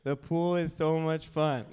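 imitating a child's dutiful repeat; lightly voiced reluctant little pupil; toddler parroting. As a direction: parrot it back like a coached toddler. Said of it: "Repeat after me, Beckham".

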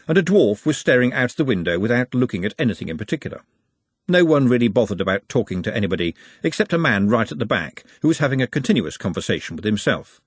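ordinary delivery, nothing marked

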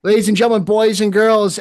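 booming showman announcement; ringmaster. Acted. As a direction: call it out like a circus ringmaster. Podcast intro announcer opening.

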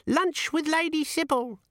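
squeaky imp announcing; giggly sneering imp. Acted. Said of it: "said the imp".

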